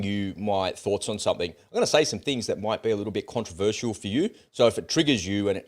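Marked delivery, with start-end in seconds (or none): none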